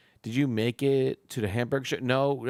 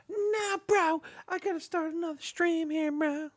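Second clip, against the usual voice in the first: exasperated high squeal. Real, not acted. Host cries out high as his stream drops. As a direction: voice shoots way up in disbelief.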